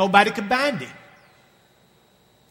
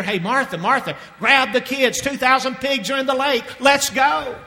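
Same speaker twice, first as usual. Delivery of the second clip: higher, eager call to his wife; excited townsman; 6 semitones higher than usual. Acted. Townsman calling his wife Martha to see the pigs, excited. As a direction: hurry the family out.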